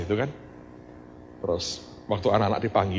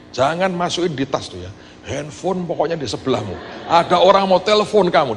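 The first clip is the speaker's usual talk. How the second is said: eager mother pressing her son, building louder; mother urging her son, excited; 7 semitones higher, 6 dB louder than usual. Retold or imitated. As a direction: push the news out excitedly.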